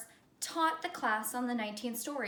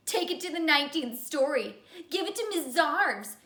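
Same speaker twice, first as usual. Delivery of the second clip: louder teasing sing-song; hooting taunt; 4 semitones higher, 6 dB louder than usual. Acted. Myron taunting Calvin as he leaves, loud hooting tease. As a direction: hoot it out to mock him. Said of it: "hooted Myron".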